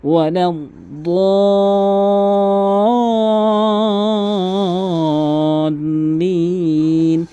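slow melodic tilawat; Quran recitation. Acted. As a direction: chant it with long held vowels.

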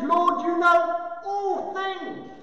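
frustrated. Acted. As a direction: spell it out slowly in frustration. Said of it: "He's now frustrated".

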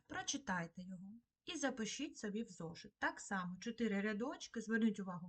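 ordinary delivery

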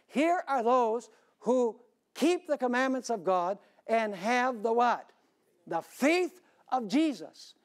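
slow, solemn reading of the verse; scripture reading. Acted. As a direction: lift the verse out for the congregation.

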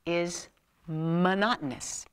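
drawn-out flat delivery; slow monotone. Shown as a demonstration. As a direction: drag the word like a bore.

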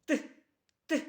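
Т as two short, separate bursts; isolated hard consonant. Shown as a demonstration. Teacher gives the last sound to children, short hard Т. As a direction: tap out Т alone, hard and brief. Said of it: "І останній звук вимовте".

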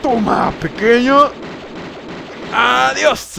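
high, loud finishing cry; triumphant shout. Real, not acted. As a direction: yell a farewell as you finish off the boss. Finishing off the boss. (grito triunfal de remate)

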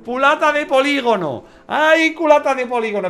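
high, loud squeals broken by laughter; giddy and flustered, tripping over the word; real nervous glee with laughs; nervous excitement. Real, not acted. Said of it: "que estoy muy nervioso".

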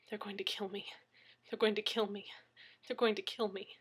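hushed, near-whispered repetition; fearful inner thought. A narrator's reading. Injured man alone with his dread, hushed fearful thought. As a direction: think it aloud, softly and fearfully. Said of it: "the only thing he could think was".

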